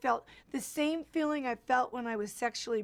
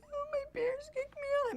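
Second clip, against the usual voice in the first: very high-pitched helpless little voice; imitated tearful naivety. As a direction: mimic your younger self wailing pitifully. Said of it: "I must have sounded like an idiot".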